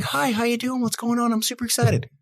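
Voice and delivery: gushing, high-pitched eager customer; super excited. Acted. Acting out a keen customer phoning for a quote, super excited. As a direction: imitate an over-eager caller in a high voice. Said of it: "I don't get inflection. I don't get the tone".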